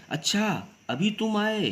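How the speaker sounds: high-pitched, demonstrated astonishment; surprise. Shown as a demonstration. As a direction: act surprised at someone just arriving. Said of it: "आश्चर्य भाव में प्रकट किया गया".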